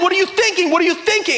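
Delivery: high, exasperated mock shout; exasperated yelling. Pastor scolding Titanic characters, high exasperated yell. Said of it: "I am just yelling at everyone".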